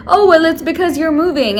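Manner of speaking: mock the nail tech shifting blame; lightly voiced nail tech brushing it off; quoting the nail tech. Nail tech blaming her, voiced.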